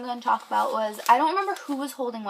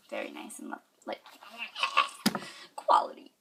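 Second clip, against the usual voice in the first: whisper; 5 dB quieter than usual. Real, not acted. Hushed aside about the hug.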